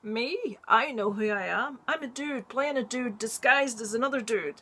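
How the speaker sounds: a hint of a swaggering actor voice; mock movie line. Quoting a favourite line as Kirk Lazarus, loudly. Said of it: "he says".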